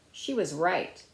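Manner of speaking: usual speaking voice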